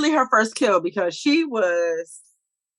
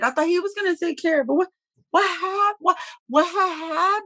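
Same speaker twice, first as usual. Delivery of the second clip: flustered disbelief; 9 semitones higher than usual. Retold or imitated.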